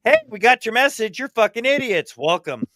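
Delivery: hammed-up sarcastic alien greeting; mocking alien. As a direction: tease humanity from the sky. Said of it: "something up in the sky going".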